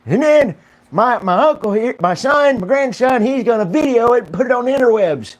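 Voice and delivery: lightly voiced high old codger; a hint of a proud old grandpa; old man holdout.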